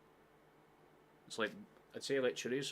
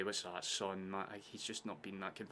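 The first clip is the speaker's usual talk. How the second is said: normal talking voice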